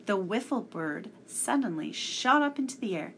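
usual speaking voice

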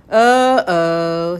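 loud, drawn alarm; see trouble coming; wary dread. A narrator's reading. Tom backs away from the bird, wary.

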